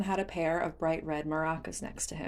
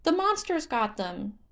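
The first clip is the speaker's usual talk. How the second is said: sadly; 10 semitones higher, 3 dB louder than usual. Acted. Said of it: "Hestu said sadly".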